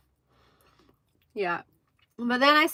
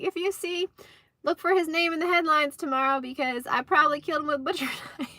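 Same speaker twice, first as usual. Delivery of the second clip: higher playful voice breaking into laughter; retold joking threat; 7 semitones higher, faster than usual. Wife joking about killing her husband, playful and laughing. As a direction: mimic a mock threat, laugh near the end. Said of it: "that was them flirting".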